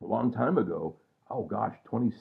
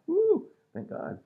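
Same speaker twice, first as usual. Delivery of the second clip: relieved woo; slower than usual. Real, not acted. Relieved exclamation, high and slow.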